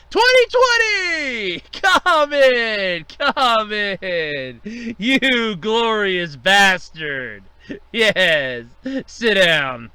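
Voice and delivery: loud, slow, booming theatrical greeting; hammed-up villain hospitality; mock grand welcome. Boss greeting year 2020 with booming mock warmth.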